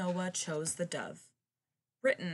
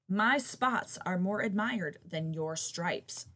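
plain reading voice

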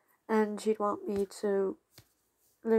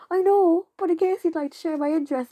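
high, loud, quick retort; imitating your own eager plea to the fans; mock pleading; 8 semitones higher, 6 dB louder, faster than usual. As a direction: act out pleading your case, pitched well up. Acting out own plea to viewers.

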